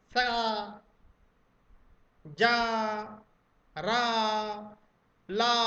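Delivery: सा, जा, रा, ला sounded one at a time, each vowel held; drawn-out teacher's demo of सा, जा, रा, ला, tongue at the gums; demonstrated letter sounds. Shown as a demonstration. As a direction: isolate सा, जा, रा, ला with long pauses between. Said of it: "इनको बोलते समय जीभ जाकर".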